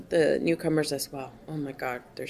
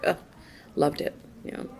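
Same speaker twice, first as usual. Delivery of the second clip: ordinary delivery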